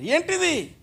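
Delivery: high, sharp ఏంటిది with a falling end; indignant outcry. Real, not acted. Preacher decries youth falling into vice, indignant. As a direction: demand an answer in disbelief.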